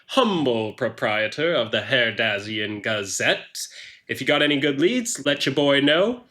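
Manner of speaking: put-on accented huckster announcing himself; showman Hein Hookman. Newspaper proprietor introduces himself to all, showy.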